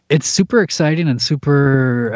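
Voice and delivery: normal talking voice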